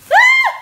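sudden high squeal of glee; excited shriek. Real, not acted. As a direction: shriek with bargain joy. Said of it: "Sorry, I'm excited".

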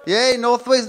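high, loud sarcastic yay; mock cheer. Comic mocking a cheer for North Wales. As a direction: fake cheer for the region.

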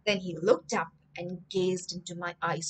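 plain reading voice

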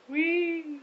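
high, loud squealed whee; playful whee. Real, not acted. Playful squeal during swaying motion.

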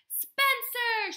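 high, loud exasperated mom yell. A narrator's reading. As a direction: shout the name up the stairs. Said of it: "she yelled".

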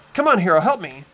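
ordinary talk

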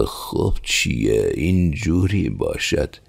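slow, puzzled mumble; hesitant, drawn-out muttering; hemming and hawing. Acted. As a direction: stall over the words, unsure what is meant. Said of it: "فقط با من و من گفت".

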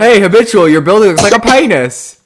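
loud heckle across the game; calling out. Real, not acted. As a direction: call it out loudly to get attention. Calls out teasing remark to builder.

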